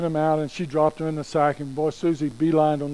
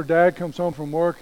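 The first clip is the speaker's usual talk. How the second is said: ordinary delivery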